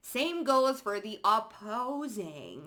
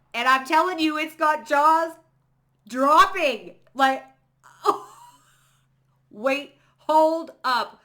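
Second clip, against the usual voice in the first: much higher and louder, bursting with excitement; excited; 8 semitones higher, 12 dB louder than usual. Real, not acted. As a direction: shout it out like a thrilling reveal. Gleeful reveal of reading.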